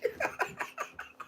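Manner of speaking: high wheezing laugh; helpless laughter. Real, not acted. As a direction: crack up at your own story. Cracks up at the memory.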